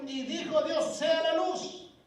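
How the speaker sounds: booming voice of God. Acted. Preacher reading Genesis aloud as God, booming. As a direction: proclaim it like the voice of God.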